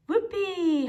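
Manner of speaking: whoopee. A narrator's reading. Rabbit zooming joyfully past boats.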